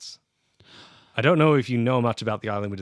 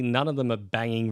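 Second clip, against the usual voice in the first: ordinary talk, nothing marked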